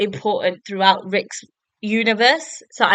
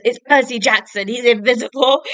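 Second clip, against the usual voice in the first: raised, louder character outburst; mock alarmed cry; 4 semitones higher, 5 dB louder than usual. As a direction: call it out like a hammed-up alarm. Voicing Luke's alarm at the intruder.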